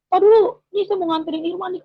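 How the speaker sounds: high, loud, fast phone call; husband telling a caller in a hurry. Retold or imitated. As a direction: rush the news down the phone.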